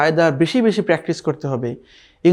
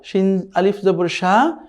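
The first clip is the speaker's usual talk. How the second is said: slow spelling; slower than usual. Shown as a demonstration. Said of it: "তাহলে কি হলো?".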